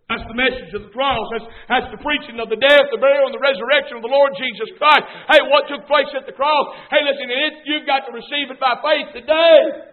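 loud, driving pulpit proclamation; building to a loud finish; full-force preaching. Real, not acted. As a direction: hammer it out to the congregation.

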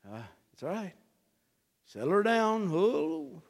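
a hint of a gentle herdsman soothing; calming the flock. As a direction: coo like a shepherd calming a nervous ewe. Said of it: "Shepherd shows up and goes".

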